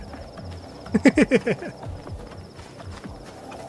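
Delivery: airy high giggle; breathy laugh. Real, not acted. Streamer laughing at a joke.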